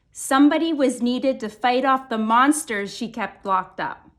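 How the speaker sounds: ordinary delivery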